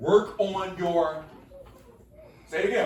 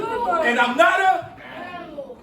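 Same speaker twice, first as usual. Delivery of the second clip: sassy, higher, louder, slow with a laugh; with attitude; 8 semitones higher, 5 dB louder, slower than usual. Shown as a demonstration. Teacher leads the chant with laughing attitude. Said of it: "Come on, say it with an attitude".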